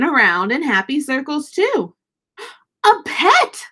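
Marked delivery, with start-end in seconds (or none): 2.8-3.7 high, thrilled squeal; high delighted cry; excited fish voice. Acted. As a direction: burst out with joy. Gilbert discovers the dog.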